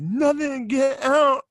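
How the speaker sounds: put-on high, petulant teen snapping to be left alone; bratty kid. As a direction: shoo the parent out like a sulky kid. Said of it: "I'd be like".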